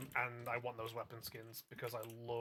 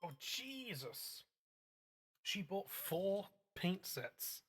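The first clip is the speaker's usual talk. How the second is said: slow, stunned disbelief; shocked; 4 semitones higher, much slower than usual. Real, not acted. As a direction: drag it out in shock. Shocked at big purchase.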